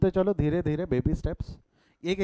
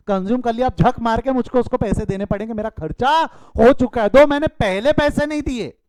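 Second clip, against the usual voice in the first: emphatic; 5 semitones higher, 7 dB louder than usual. Acted. Teacher driving home the point.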